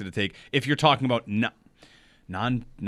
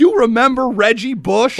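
higher, louder, punchy question; hyped-up; 9 semitones higher, 7 dB louder than usual. Real, not acted. Host hyping a player to his co-host, hyped-up. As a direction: pitch it up big and loud.

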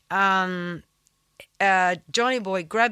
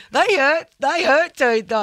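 laughing; 8 semitones higher, 8 dB louder than usual. Real, not acted. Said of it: "laughing recalling the slap".

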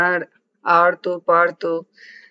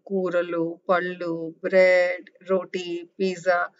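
usual speaking voice

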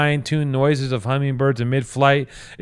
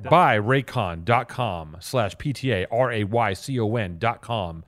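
ad read; 4 semitones lower, 5 dB quieter than usual. Acted. Reads ad promo code.

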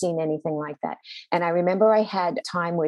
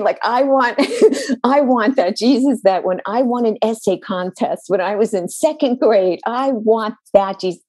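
higher and louder, crying out with a broken laugh; anguished outburst; 4 semitones higher, 8 dB louder than usual. Retold or imitated. To her supervisor, an anguished cry for her childhood Jesus. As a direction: pour out the longing for the old Jesus. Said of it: "And I'm sobbing".

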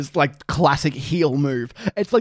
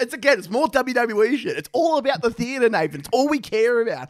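higher, heated comic tirade; mock rant; 6 semitones higher than usual. Real, not acted. Ranting to his co-host about tennis for laughs. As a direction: rail against tennis for laughs.

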